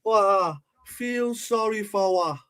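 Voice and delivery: high, slow mock Jamaican accent again for laughs; playful Jamaican accent impression; Jamaican accent imitation. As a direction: stretch it out in a high Jamaican lilt. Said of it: "does make me laugh ... accent".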